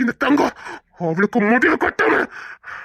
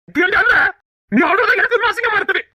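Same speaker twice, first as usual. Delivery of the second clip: angry scolding; 13 semitones higher than usual. Acted. Husband scolding crying wife.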